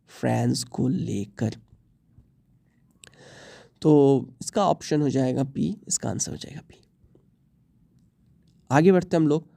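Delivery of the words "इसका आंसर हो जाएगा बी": low, near-whispered aside; hushed repeat. Real, not acted. Teacher repeats the answer to himself, hushed. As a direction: drop it almost to a whisper.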